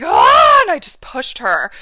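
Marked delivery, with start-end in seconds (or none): 0.0-0.6 a loud, high, raw scream of rage; raging scream. Retold or imitated. Bullied girl finally pushing back at her friend, raging scream. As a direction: let it all out in one furious yell. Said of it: "And I stood up and I was like".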